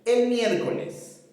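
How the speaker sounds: slow model of el miércoles; demo word. Shown as a demonstration. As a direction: present el miércoles slowly and clearly. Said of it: "el ejemplo siguiente".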